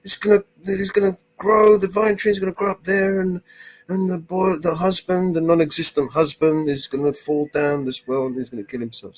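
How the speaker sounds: hammed-up tearful wail; mock wailing. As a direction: wail it like a silly melodrama. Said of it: "they both started crying".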